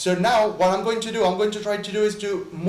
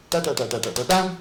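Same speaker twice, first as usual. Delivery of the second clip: vocalized drum strokes; 3 semitones lower, faster than usual. Shown as a demonstration. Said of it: "another single stroke now with six notes".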